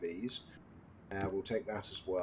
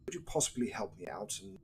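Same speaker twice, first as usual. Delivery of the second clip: posh Queen's English; 2 semitones higher than usual. Acted. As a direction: play a mock-genteel English gent in a Queen's English accent. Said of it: "the elevated British accent, so the Queen's English".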